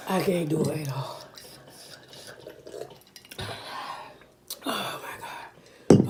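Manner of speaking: exhausted whisper. Real, not acted. Overwhelmed by heat.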